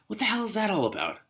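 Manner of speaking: high, disbelieving aside; baffled, can't believe it; incredulous. Real, not acted.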